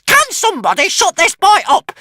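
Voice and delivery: very high, loud snap; snapping witch. A narrator's reading. Witch wants the boy silenced, snapping loudly. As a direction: snap in shrill exasperation. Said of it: "snapped the witch".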